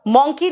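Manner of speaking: मंकी as "mon-kee"; rounded "aw" in मंकी; mispronunciation of monkey. Shown as a demonstration. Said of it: "मंकी नहीं कहते हैं". (मंकी को गलत "मॉन्की" बोलें)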